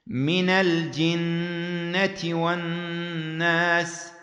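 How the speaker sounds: slow chant with من read as mina al-jinna; fatha on min. Shown as a demonstration. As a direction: stretch من الجنة so the nun takes a fatha. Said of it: "تفتح". (رتّل من الجنة بفتح النون: مِنَ الجنة)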